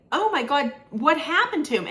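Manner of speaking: mock worried gasp of a question; shocked concern. Searchers asking her what happened to the boy, shocked concern.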